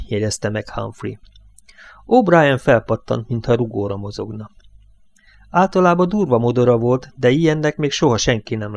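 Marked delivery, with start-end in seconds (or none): none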